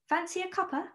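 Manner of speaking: British line, Fancy a cuppa lifted; British accent. Shown as a demonstration. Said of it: "we call it a cuppa".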